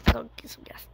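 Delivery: quick whispered aside. Real, not acted. Girl whispering an aside mid-song.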